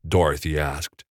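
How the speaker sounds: usual speaking voice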